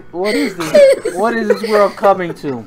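normal talking voice

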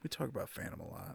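a sly whispered aside to the others; hushed teasing whisper. Real, not acted. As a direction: whisper it under your breath. Aside about their Phantom habit.

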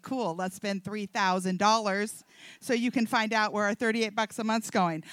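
low imitation of her husband; husband's reply. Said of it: "That's what he did. He's like".

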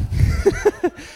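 real high laugh. Real, not acted. Laughing at the cheeseburger memory.

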